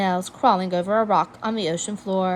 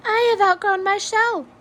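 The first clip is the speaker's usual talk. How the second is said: small hermit crab; 12 semitones higher, 3 dB louder than usual. A narrator's reading. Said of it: "she said".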